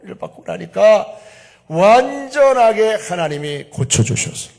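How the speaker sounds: loud, slow, rising sermon cry; full-force preaching. Acted. Preacher proclaiming healing to the congregation, loud and slow.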